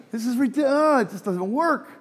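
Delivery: high-pitched, exaggerated incredulous protest; mock exasperation. American reacting to talk of church schemes, mock exasperated. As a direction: throw it out as a hammed-up objection.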